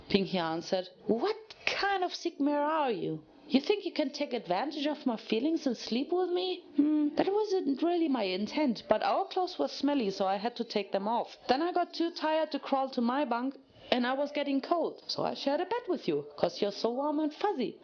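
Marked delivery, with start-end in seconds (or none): 1.1-6.6 raised, indignant pony voice, higher pitch; outraged accusation. Acted. Applejack accusing Pinkie, outraged. 6.8-17.8 high, bubbly, cheerful pony voice; innocent Pinkie voice. Acted.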